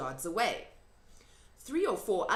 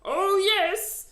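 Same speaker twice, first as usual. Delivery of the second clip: high, loud insistence; the giant insisting to Sophie; firm loud giant; 13 semitones higher, 11 dB louder than usual. A narrator's reading. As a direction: answer firmly as the giant. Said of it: "said the B F G".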